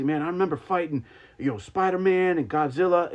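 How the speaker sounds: rising, gushing excited fan. Retold or imitated. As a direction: spill it out like a starstruck fan. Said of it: "I was just very excited".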